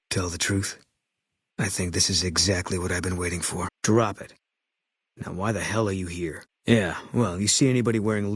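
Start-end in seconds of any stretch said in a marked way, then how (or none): 3.8-4.3 curt command. Acted. Sniper shutting down the player's question, curt command. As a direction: snap the order to end it.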